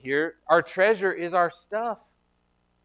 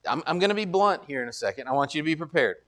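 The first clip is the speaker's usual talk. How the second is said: ordinary talk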